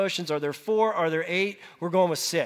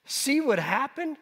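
stunned; 6 semitones higher, 4 dB quieter than usual. Retold or imitated. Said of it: "complete shock".

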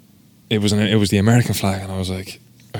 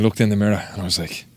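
usual speaking voice

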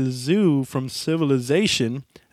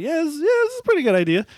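much higher, fast self-convincing; forced optimism; 11 semitones higher, faster than usual. Retold or imitated. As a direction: talk yourself into it. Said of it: "I'm like".

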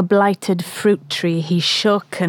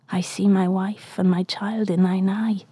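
softer, whispered longing; tender whisper; 2 semitones lower, 6 dB quieter than usual. Acted. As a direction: whisper it as if seeing home. Ahab thinks tenderly of family.

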